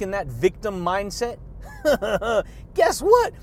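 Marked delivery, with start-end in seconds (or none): 1.4-2.7 a chuckle before the punchline; quick laugh. Real, not acted. Vlogger laughing before his punchline. 2.7-3.3 loud, much higher guess what; shouted question. Real, not acted. Vlogger shouting a teaser at his viewers.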